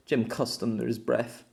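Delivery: ordinary delivery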